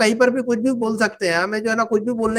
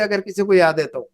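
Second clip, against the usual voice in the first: ordinary talk, nothing marked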